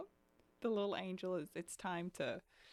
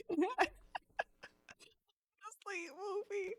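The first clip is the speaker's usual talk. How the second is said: high, breaking voice, half laughing through tears; retold crying. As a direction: imitate yourself crying from exhaustion. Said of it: "And I'm like".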